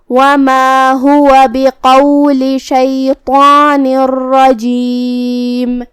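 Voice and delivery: drawn-out melodic tajweed chant; slow Quran recitation. Acted. A teacher reciting the verse for students, slow melodic chant. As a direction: recite the verse slowly with full elongations.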